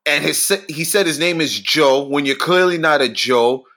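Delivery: ordinary talk, nothing marked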